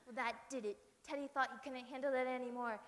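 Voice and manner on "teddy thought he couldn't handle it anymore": ordinary delivery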